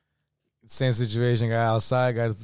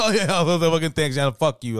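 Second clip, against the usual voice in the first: high, loud sarcastic thanks; 6 semitones higher, 6 dB louder than usual. Retold or imitated. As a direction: fire back a mock thank-you. Sarcastic retort after being laughed at.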